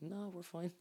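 playful mock reply to worried foreigners; light mock reassurance. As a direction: toss it off in a put-on breezy voice. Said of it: "You're like".

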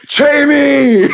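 high, loud mock shout of a name, laughing; mock Arnold yell. Retelling the movie, mock yell for his kid, laughing. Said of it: "it's like".

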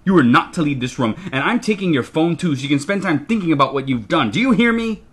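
loud, emphatic parental tirade; furious scolding. A narrator's reading. Father grounds his child while the family goes out, furiously. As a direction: lay down the law, building to a high demand.

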